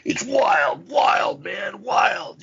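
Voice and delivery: high, hammed-up stage cry. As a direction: imitate a wild metal singer's rant. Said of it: "where Cronus is like".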